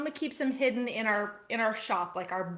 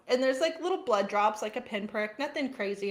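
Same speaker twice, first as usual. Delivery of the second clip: ordinary delivery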